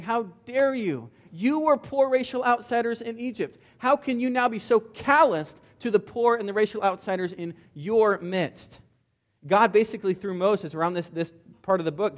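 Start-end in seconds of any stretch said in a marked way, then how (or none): 0.0-8.8 a preacher's reproach, mildly acted; chastising. Moses scolds the Israelites for forgetting Egypt, a pitched-up rebuke. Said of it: "Moses is having to chastise them".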